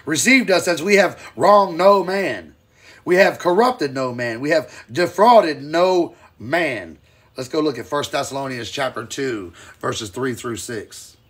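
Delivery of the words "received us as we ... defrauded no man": loud, slow pulpit reading; forceful scripture reading. Acted. Preacher reads the verse to his listeners, loud and slow. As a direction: lean hard on each line of verse.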